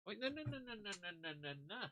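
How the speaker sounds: high, soft no-no-no run that falls in pitch; high pleading to the dog. Real, not acted. To the dog jumping up: high, soft pleading. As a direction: gently stop the dog with a high voice.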